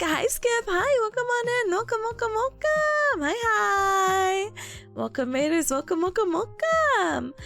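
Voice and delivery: high, bubbly welcome; excited greeting. Real, not acted. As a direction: squeal a welcome to the raiders.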